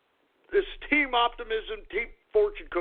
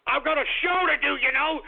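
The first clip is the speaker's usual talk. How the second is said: ordinary talk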